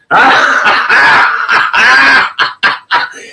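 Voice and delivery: high, loud cackle in bursts; real shrieking burst of laughter. Real, not acted. Host cracks up after the toilet remark, loud high cackle.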